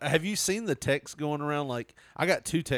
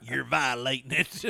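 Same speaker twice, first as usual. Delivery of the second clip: put-on belligerent drunk. Playing redneck homeowner.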